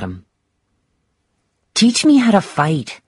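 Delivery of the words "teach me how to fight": put-on high-pitched child's voice; little daughter's high request; little girl. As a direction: ask your father like an eager small child. Narrator voices young daughter asking.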